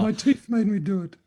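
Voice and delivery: high, loud silly excuse; mock Franklin excuse. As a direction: whine the excuse like a caught old man. Wooden teeth joke voice.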